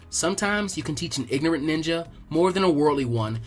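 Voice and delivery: usual speaking voice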